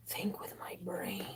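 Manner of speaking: slow soft whisper to herself. Real, not acted. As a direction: breathe the words while focusing. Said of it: "I'm gonna have to be quiet".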